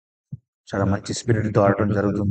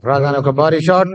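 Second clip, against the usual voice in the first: excited cricket commentary; 5 semitones higher, 5 dB louder than usual. Real, not acted. Big shot called in commentary.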